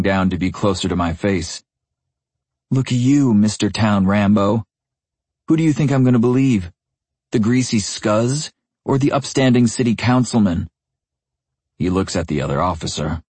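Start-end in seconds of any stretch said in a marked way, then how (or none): 2.7-10.7 taunting sneer. A narrator's reading. Cop taunting pinned suspect.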